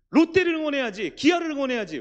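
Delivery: high, loud mock outrage; indignant fan's objection, acted; mock protest. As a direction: protest loudly, pitched well up. Mock protest at fans switching teams.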